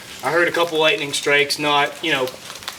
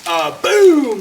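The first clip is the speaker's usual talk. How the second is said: loud boom; 12 semitones higher, 5 dB louder than usual. Retold or imitated. Retelling the tree exploding down.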